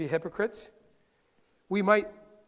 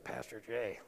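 gossiping Brenda; slower than usual. Retold or imitated.

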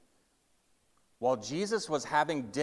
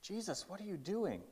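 higher, much softer exasperated question; hushed disbelief; 6 semitones higher, 11 dB quieter than usual. Acted. Townsman questioning Jesus about the tax collector, hushed disbelief. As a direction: plead under your breath in disbelief.

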